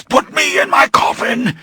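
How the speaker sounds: high, straining shout; hoarse cry. Acted. Dying vampire shouts his plea to Louis. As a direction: force it out as a cry. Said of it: "His voice was hoarse".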